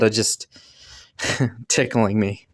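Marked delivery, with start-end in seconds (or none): none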